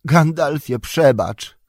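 high, loud plea; pleading shout, falling at the end; crying out. A narrator's reading. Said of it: "krzyknął".

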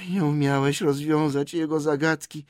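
Gollum's voice. Acted. Gollum repeats Bilbo's reproach, in a creature voice. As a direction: echo it in a peevish creature whine.

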